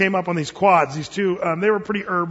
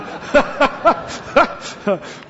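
airy laugh; 7 semitones higher than usual. Real, not acted. Laughing at George's joke.

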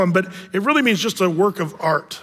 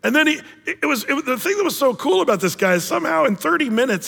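usual speaking voice

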